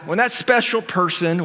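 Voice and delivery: normal talking voice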